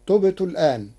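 ordinary delivery

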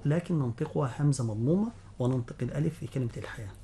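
ordinary talk, nothing marked

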